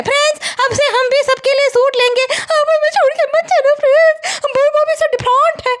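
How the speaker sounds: high, whiny imitation of a pleading vlogger; mock pleading vlogger. Vlogger begging followers not to leave, mock pleading.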